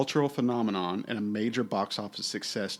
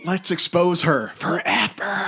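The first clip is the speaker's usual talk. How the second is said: slow, loud, higher mock declaration; hammed-up exposé; mock dramatic; 6 semitones higher, 6 dB louder, slower than usual.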